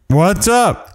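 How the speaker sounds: high, abrupt mock-stern what's up. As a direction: act out an annoyed husband's sharp challenge. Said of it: "in a loud voice and, like, a stern voice".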